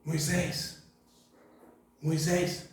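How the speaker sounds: soft grandmotherly call; softly, like a granny calling; grandma voice. Retold or imitated. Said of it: "será que foi voz de vó".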